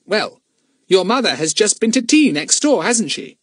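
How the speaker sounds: lightly voiced eager boy; young boy, high and eager; Fatty's voice. A narrator's reading.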